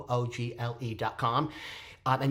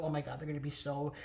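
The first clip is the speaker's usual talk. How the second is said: muttered aside; 10 dB quieter, faster than usual. Real, not acted. Worried aside about cast tension.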